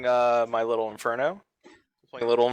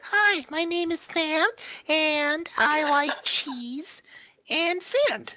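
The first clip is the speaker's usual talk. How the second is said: much higher, slow, goofy character voice; a cartoonish high voice introducing itself; high, dopey, slowed-down kid voice; silly character voice; much slower than usual. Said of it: "and then you can be like".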